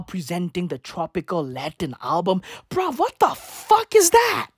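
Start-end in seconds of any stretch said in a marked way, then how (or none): none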